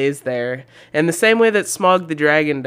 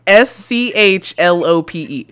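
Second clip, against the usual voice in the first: ordinary delivery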